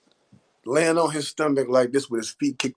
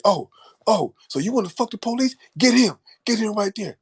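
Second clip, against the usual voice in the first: 비꼬는 경찰 흉내, 높은 목소리; mocking cop; 10 semitones higher than usual. Police voiced grabbing him.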